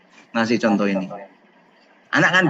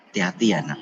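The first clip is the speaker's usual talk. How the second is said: gentle; 8 semitones lower, 6 dB quieter, faster than usual. Acted. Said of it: "kata yang bijak".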